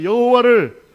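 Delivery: very loud, high rallying shout. Shown as a demonstration. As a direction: belt it out for the congregation to echo. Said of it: "크게 따라하세요". (아주 크게 외치는 선창)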